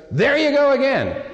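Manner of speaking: high-pitched mock exasperated outburst; angry wife imitation. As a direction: imitate a wife who has finally had enough. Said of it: "she's forty clumps angry".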